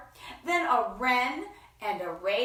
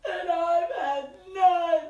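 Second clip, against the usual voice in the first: high, loud blubbering bear wail; whimpering bear; 9 semitones higher, 6 dB louder than usual. Acted. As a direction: sob it out like a sulky bear. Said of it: "He whimpers and he moans".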